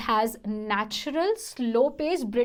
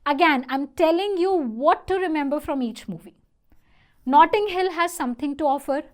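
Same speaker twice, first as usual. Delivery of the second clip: usual speaking voice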